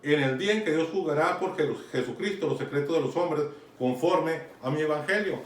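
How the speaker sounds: ordinary delivery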